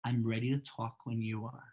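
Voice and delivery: soft, low, unruffled reply; calmly offering to talk, voice dropped. Shown as a demonstration. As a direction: keep it quiet and down, refusing to escalate. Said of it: "just stay as low as you can and say".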